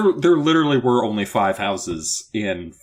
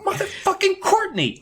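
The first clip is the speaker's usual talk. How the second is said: high, squealed mock exasperation; hammed-up high-pitched outrage. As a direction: curse the name in a high voice. Acting out an exasperated character.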